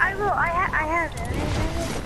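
ordinary talk

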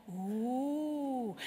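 long, smooth ooh of awe; awed ooh. Shown as a demonstration. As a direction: draw out a gentle sound of awe. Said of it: "I want you all to go".

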